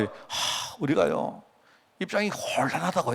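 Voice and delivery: sighed, hushed mock excuse; retold awkward confiding. Official takes the pastor aside to explain, awkward and hushed. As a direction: imitate a reluctant official, softly after a sigh.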